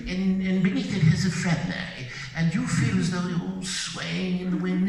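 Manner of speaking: rising, lofty mock director's direction. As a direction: play an arty director getting carried away. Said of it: "If a director says".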